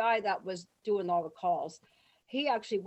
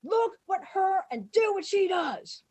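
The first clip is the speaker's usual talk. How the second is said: high, loud, emphatic orders; yelled command; 9 semitones higher, 5 dB louder than usual. Retold or imitated. Director ordering extras to copy her, yelling. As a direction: holler orders at the whole crowd. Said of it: "Lloyd starts screaming".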